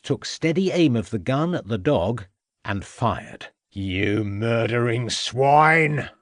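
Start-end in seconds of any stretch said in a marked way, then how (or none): 3.7-6.1 growled. A narrator's reading. Farmer growling at the inspector who shot his dog. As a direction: spit the words out slowly. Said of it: "growled Troutbridge".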